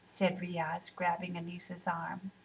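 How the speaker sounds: ordinary delivery, nothing marked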